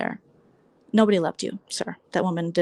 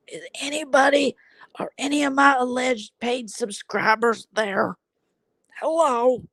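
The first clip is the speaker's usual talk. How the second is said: mock confused streamer; 5 semitones higher, much slower than usual.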